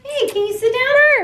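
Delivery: high coaxing voice to a dog; sweet high pet-talk command; squeaky praising a dog. Real, not acted. Asking dog to sit.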